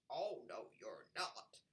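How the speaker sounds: low, soft frog croak; a lightly voiced low frog. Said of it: "croaked a frog".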